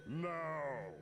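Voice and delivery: booming high giant voice; dopey cartoon bellow. Game giant speaks.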